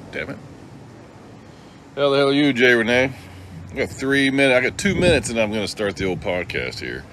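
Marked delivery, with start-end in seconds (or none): none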